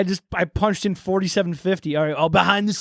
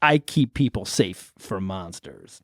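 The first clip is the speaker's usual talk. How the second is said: much lower, slow; low slow drifter; 13 semitones lower, 4 dB quieter, slower than usual. Acted.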